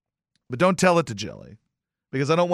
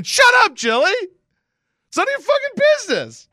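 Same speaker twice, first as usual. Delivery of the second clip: high, loud mock outburst at his wife; hammed-up fake fury at wife; mock angry snap; 6 dB louder than usual. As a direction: bark it at her, hammed-up. Retold snapping at wife.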